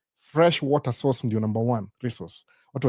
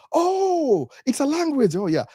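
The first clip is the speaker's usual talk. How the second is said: squeaky high "oh", amazed; playing her dawning surprise, pitched up; high surprised; 13 semitones higher, much slower than usual. Sister-in-law learns Swahili is a language, high and surprised. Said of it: "That's Swahili now".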